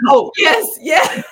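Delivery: 3 burst out yes like you can't believe it; 1 overjoyed yes. Retold or imitated. 4 accepting his proposal in his arms, joyful high-pitched shout.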